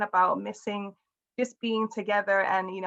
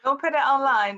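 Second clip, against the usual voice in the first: teasing warning; 4 semitones higher, 5 dB louder than usual. Real, not acted. Friend teasing about seeing people.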